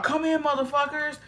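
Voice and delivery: lightly voiced mock invitation. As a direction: parody her reckless welcome. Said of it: "Talking about".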